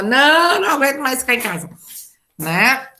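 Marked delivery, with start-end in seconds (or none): 0.0-1.7 higher, louder, fast exasperated answer; mock fed-up reply. Shown as a demonstration. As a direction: complain fast, like a student sick of home. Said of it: "eu só mudo o tom de voz". (aluno reclamando rápido, meio exagerado)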